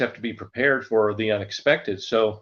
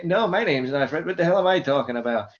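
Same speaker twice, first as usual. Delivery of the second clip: high, confused forgetful guy; Buddy voice; 6 semitones higher than usual. Retold or imitated. Buddy confusing a telemarketer about names, high and muddled. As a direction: lose the thread, pitched up. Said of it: "the forgetful kind of guy".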